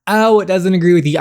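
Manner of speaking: sarcastic sing-song brush-off; mocking dismissive quote. Player mocking people who dismiss her stomach pain, sarcastic. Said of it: "not like a classic".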